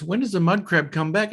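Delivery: high-pitched ranger in a joke; questioning ranger. Retold or imitated. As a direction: set up the punchline as the asking ranger. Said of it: "and then Ranger goes".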